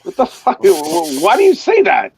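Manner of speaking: rising high incredulous outburst; asked if he's from Poland, mock shocked; mock shocked disbelief. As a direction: act stunned and offended. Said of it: "I'm like".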